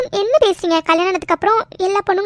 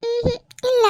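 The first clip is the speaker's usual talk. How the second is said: tearful; 5 semitones higher than usual. Acted. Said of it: "நீ அழுதுட்டு இருக்க".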